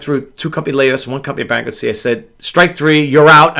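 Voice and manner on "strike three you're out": loud, raised declaration; punchy and higher, building louder; emphatic umpire-style call. Acted. Umpire-style call on his own career.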